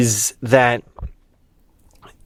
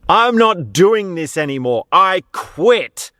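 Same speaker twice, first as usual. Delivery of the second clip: raised, emphatic retold outburst; snapping clown; 7 semitones higher than usual. Clown snaps halfway through his act, retold outburst. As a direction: act out a fed-up performer quitting, pitched up. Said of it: "he snaps and he goes".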